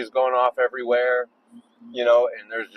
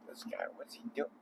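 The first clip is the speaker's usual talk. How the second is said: muttered; 19 dB quieter than usual. Real, not acted. Muttering at another driver.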